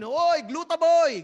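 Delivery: high, slow mocking call to a coworker; teasing call. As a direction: sing out a teasing nickname across the office. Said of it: "Gaganon yun eh".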